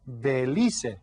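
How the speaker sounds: Belize said slowly as beh-LEE-seh; Spanish pronunciation. Shown as a demonstration. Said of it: "you pronounce all of the letters in Spanish".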